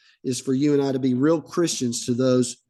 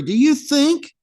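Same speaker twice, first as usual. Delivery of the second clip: suspicious nobles; 6 semitones higher, 4 dB louder than usual. Acted. Nobles' suspicious question from scripture.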